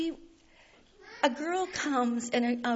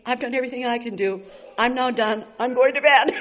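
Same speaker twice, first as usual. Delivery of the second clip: getting louder, ending in a laugh; exasperated giving up; 10 dB louder, faster than usual. Retold or imitated. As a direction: throw up your hands at God. Telling the Lord she gives up.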